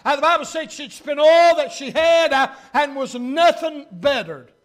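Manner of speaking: loud, driving pulpit delivery; preaching. Real, not acted. Preaches at full force.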